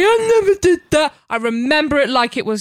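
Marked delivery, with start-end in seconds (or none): 0.0-1.1 high, loud mimicry of an innocent denial; mock someone playing clueless; put-on protest of innocence, pitched up; mock feigned ignorance. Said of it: "feign complete ignorance now and say".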